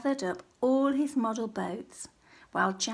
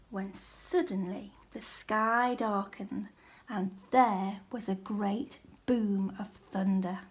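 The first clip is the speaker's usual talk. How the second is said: usual speaking voice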